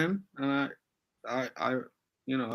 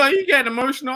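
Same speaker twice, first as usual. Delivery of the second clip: pitched up high and louder, half taunting; incredulous question; 11 semitones higher, 6 dB louder, faster than usual. Real, not acted. Panelist needles the host for getting worked up, incredulous. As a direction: poke at her with a raised, disbelieving question. Host snaps at her over baby.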